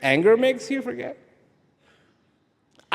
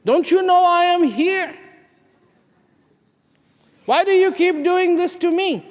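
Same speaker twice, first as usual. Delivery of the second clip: complaining to God; 10 semitones higher, 4 dB louder than usual. Retold or imitated. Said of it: "angry at God".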